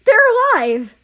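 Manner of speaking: high, loud cry of relief; loud ecstatic shout; joyful yell. Acted. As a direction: shout the good news with joy. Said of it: "Germany yelled".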